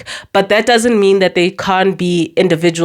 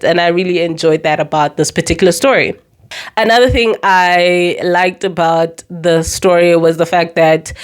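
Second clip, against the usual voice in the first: usual speaking voice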